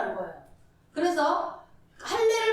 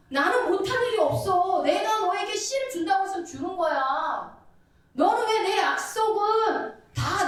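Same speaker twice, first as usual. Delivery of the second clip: higher, louder, slow scolding; stern rebuking God; 5 semitones higher, 4 dB louder than usual. Acted. God scolding Abraham for not waiting, loud stern rebuke. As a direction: scold like a father who kept silent for years. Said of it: "이거는 무서운 질책을 얘기하는 거죠".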